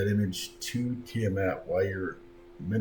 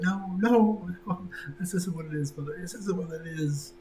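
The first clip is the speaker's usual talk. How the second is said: put-on higher, pleading girl's voice; pleading daughter; 10 semitones higher than usual. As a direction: beg your mother to understand.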